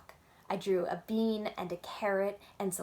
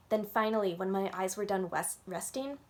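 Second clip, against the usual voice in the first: plain reading voice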